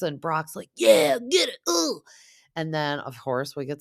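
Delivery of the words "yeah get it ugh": loud, high hype yell with a grunt; imitated cheering-on, pushed up high and loud; Brock. Brock egging on the wall smashing, mock hype yell.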